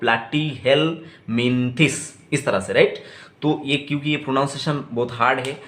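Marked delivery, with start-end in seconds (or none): 0.0-1.9 platyhelminthes drawn out slowly; slow syllables. Shown as a demonstration. Teacher asks class to repeat, slow syllables. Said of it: "मेरे साथ एक बार बताने का कोशिश करो".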